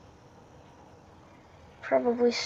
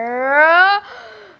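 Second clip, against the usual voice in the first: sharp gasp; 7 semitones higher, 12 dB louder than usual. Real, not acted. Sudden danger.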